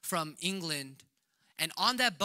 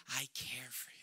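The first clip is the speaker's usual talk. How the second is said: tender whisper; 6 semitones lower, 14 dB quieter than usual. Retold or imitated. Jesus voiced tenderly.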